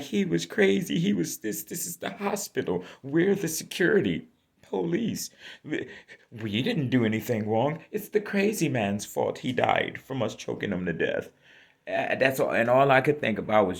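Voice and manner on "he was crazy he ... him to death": high mock voice of the guards. Speaker mocking hospital security's excuse, high and mocking. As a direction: imitate the guards' excuse, pitched up. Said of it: "then be like".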